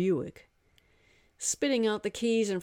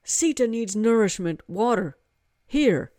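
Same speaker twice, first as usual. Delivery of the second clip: a hint of a commanding young man, a bit louder; lightly voiced order; 4 semitones higher, 7 dB louder than usual. Hari ordering the god to bring food, lightly firm. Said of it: "Hari ordered".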